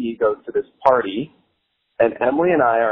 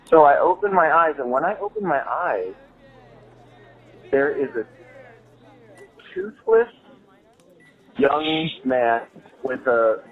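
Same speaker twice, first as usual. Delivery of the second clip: ordinary talk, nothing marked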